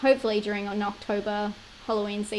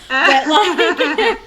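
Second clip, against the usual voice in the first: laughing; 6 semitones higher, 9 dB louder than usual. Real, not acted. Laughing at her own bit.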